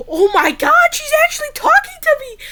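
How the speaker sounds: high, squeaky mock gush; mock Bayley squeal. Wrestling fan mimics Bayley squealing with high delight. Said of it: "Bayley said".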